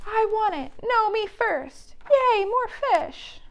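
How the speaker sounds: high squeaky demanding voices; greedy penguins. Acted. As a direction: squabble over the fish.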